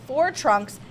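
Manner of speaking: usual speaking voice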